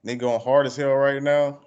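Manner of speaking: usual speaking voice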